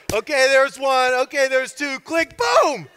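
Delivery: high, loud count to a boom; mock countdown. Preacher mocking a one-two countdown, building to boom. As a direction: count as if winding up a punch.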